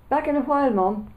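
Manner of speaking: high, loud call through the house. A narrator's reading. Boy calling to his mum upstairs, loud. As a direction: call it out to another room. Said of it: "I called out".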